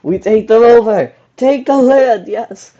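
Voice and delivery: gloating battle cry; triumphant shout. Real, not acted. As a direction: cheer the conquest at the top of your voice. Gleeful conquest cheer.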